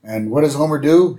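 ordinary talk, nothing marked

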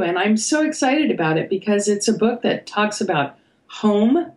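ordinary delivery

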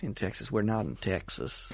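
put-on butchered American accent. Host plays along with co-host's Texas remark, mock Texan accent. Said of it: "I've just butchered your accent".

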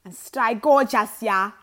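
slow and loud; loud, drawn-out farewell. Real, not acted. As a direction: belt out the sendoff.

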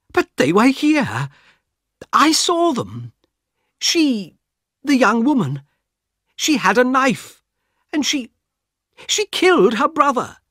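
a frightened passenger begging for help; urgent Mister Harris. Acted. Harris insists he saw them.

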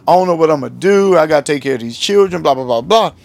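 usual speaking voice

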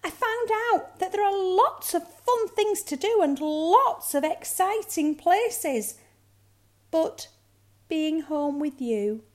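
high, loud, eager little voice; excited cub. A narrator's reading. Cub reports to mother, high and excited. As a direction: gush about it in a cub voice.

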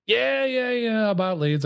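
very high, quick retold eagerness; answering a bit too keenly; eager yes. Retelling answering the mentor about leads, high and eager.

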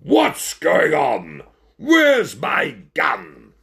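furious character shout; shouting ringmaster. A narrator's reading. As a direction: bellow with drawn-out words. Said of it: "ringmaster could be heard shouting".

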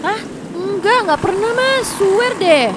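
high, panicky denial; flustered denial. Acted. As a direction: deny it in a fluster. Denies dating Ian to crush.

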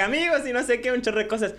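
shouted high stream greeting; hammed-up streamer hype shout. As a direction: yell it like opening a stream. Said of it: "Yo grito".

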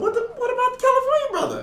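fast, very high outrage; incredulous. Real, not acted. Host asking about the missing brother, high and fast. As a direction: demand an answer. Shocked question.

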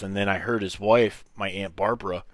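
ordinary delivery

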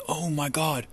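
startled agent. Retold or imitated. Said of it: "And the guy goes".

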